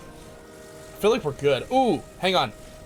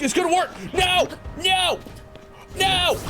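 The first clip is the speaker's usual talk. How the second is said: high-pitched cries of dismay; yelling in gamer panic; dismayed shout. Real, not acted. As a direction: cry out as it all goes wrong. Game train fails, crying out.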